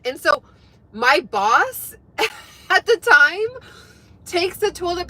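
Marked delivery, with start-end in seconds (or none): none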